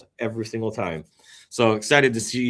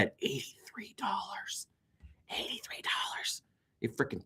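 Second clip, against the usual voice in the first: stunned whisper; 8 semitones higher, 14 dB quieter than usual. Real, not acted. Shocked at the Solana price.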